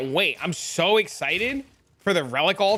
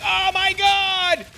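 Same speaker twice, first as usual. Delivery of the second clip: mock amazed gasp; 10 semitones higher, 5 dB louder, much slower than usual. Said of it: "everybody was like".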